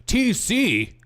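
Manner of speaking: high, exaggerated shocked question; mock astonishment. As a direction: imitate a gossiping crowd's alarm. Mimics everyone's excited reaction.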